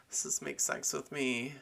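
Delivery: ordinary delivery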